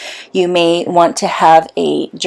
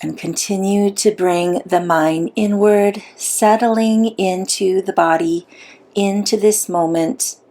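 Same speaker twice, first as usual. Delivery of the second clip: unhurried meditation guide with long pauses; soothing, measured visualization voice; guided meditation; 3 dB quieter than usual. Acted. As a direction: guide them gently inward, leaving long silences.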